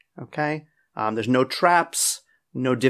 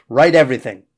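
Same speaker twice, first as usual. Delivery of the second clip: emphatic urging; 8 dB louder, faster than usual. Real, not acted. Teacher urging students to write.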